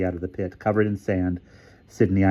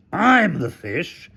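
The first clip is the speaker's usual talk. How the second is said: high, slow character shout; 10 semitones higher, much slower than usual. A narrator's reading. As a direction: shout it back, indignant. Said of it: "shouted Hamilton".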